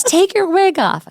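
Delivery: put-on very high, loud, slow child's demand; bossy little girl. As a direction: insist like a pushy kid. Daughter demands.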